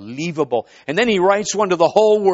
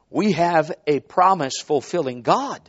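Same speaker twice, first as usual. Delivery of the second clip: ordinary talk, nothing marked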